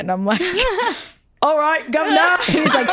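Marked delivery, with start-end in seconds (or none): 1.4-2.4 high, cheeky mock British; raised-pitch British accent, played for laughs; hammed-up British accent. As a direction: put on a cartoonish British "governor" voice. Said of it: "Do a British accent".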